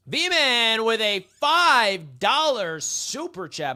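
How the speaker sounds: loud, slow, drawn-out announcer call; big stretched hype call; hype shout-out. Real, not acted. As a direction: announce the donor like a ring announcer. Announcing a super chat.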